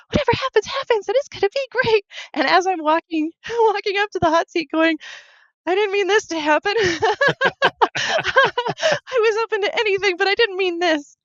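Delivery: high-pitched, laughing through the words; helpless giggles breaking into the retelling; laughing while talking. Real, not acted. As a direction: laugh at your own predicament, voice lifted high. Laughing at her own predicament retold.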